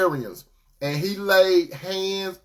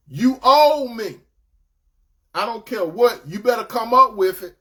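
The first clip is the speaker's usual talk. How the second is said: hard demand; 4 semitones higher, 4 dB louder than usual. Acted. Unforgiving spouse to their mate, loud insistent demand. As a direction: act out someone insisting on being paid.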